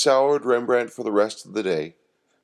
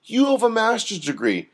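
lamenting; 8 semitones higher than usual. A narrator's reading. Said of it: "lamented Rembrandt".

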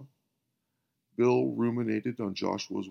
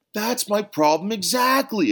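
much higher, loud exclaim; emphatic agreement; 12 semitones higher, 8 dB louder than usual. Acted. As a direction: burst out in eager agreement.

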